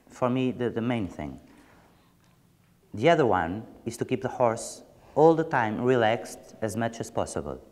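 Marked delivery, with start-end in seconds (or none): none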